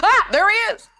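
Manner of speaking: sudden loud, high-pitched fake scare; mock alarmed yell. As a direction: yelp as if spotting a fugitive. Pretends to spot the fugitive.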